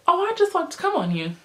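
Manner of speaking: high, teasing imitation; mock flirty.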